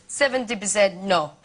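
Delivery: lightly put-on Russian accent, flat. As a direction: quote husband in his accent. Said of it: "He went".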